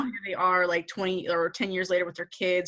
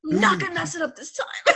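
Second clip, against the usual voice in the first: higher, lightly voiced self pep talk; mock pep talk; 9 semitones higher than usual. Fan imitating Emily talking herself up, high mock pep talk. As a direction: psych yourself up, pitched high, half in jest. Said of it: "giving herself a pep talk".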